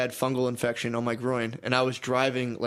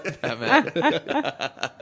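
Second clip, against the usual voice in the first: laughing; 3 semitones higher, 3 dB louder than usual. Real, not acted. Laughing at his own porn name.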